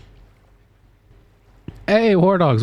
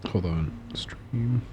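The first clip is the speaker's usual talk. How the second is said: quick whispered aside; 3 semitones lower, 11 dB quieter, much slower than usual. Real, not acted. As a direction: breathe it out unvoiced.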